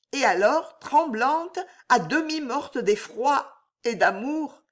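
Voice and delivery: a hint of a young woman in love; Juliette's voice.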